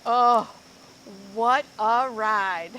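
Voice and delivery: loud, slow thrilled exclaim. Acted. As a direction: marvel out loud at the ride. After tumbling over waterfall.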